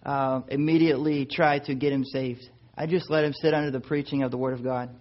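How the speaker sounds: usual speaking voice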